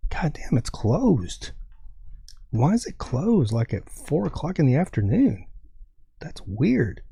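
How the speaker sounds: baffled, thinking aloud to yourself; mild disbelief, pitch swooping up on key words; retold bafflement. Retold bafflement at shuttered store.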